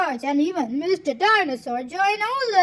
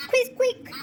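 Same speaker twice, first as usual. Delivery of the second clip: excited cry; 8 semitones higher than usual. A narrator's reading. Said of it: "cries Rebecca Rabbit".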